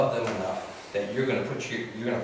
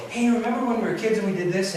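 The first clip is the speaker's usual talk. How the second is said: higher-pitched, chatty reminiscing; upbeat caller; 11 semitones higher, 4 dB louder than usual. Acted. Suicidal friend calls to reminisce, upbeat. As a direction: act a cheery old friend on the phone. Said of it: "you seem really upbeat".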